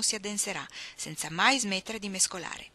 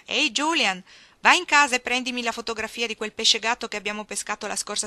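louder, higher call across the yard; 4 semitones higher, 8 dB louder than usual. A narrator's reading. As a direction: call out to the old man under the oak tree. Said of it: "chiamò il vecchio sotto la quercia".

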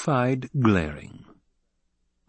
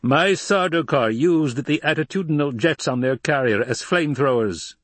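raised, pressing imperial voice; exasperated ruler; indignant Emperor; 7 semitones higher, 4 dB louder than usual. Acted. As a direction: harangue from the throne, pitched high.

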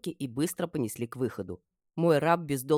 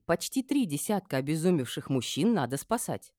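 usual speaking voice

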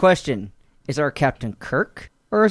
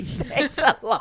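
laughing thanks; 7 semitones higher, 3 dB quieter, much slower than usual. Real, not acted. Mock thanks for being handed the plan.

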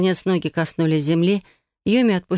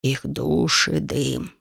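put-on mournful old sage; 2 semitones lower, much slower than usual.

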